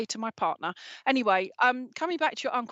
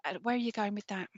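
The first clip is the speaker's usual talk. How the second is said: ordinary delivery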